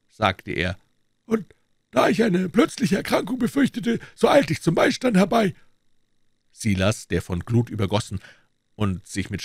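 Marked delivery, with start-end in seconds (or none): 1.2-5.5 a lightly voiced doctor, a little higher; a hint of a composed doctor; the doctor explaining why he came in, lightly voiced. A narrator's reading. Doctor speaking in the story.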